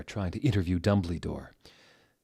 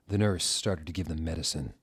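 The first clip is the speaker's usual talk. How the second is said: ordinary talk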